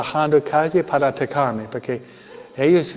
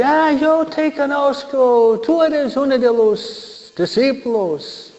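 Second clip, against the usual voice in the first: high, slow, put-on voice of an accuser in the crowd; a stranger's high, drawn-out accusation; accusing bystander; 9 semitones higher, 3 dB louder, slower than usual. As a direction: point him out slowly in a raised, pointed voice. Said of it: "Uno dice".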